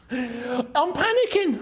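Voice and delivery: high, slow, laughing panic; giggly, mock-helpless panic; nervous laughing. Real, not acted. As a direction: laugh through the nerves. Nervous about the substitutions.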